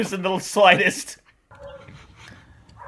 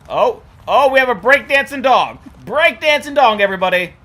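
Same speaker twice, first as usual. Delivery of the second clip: excited announcer; 4 semitones higher, 6 dB louder, faster than usual. Real, not acted. Announcing dog's dance.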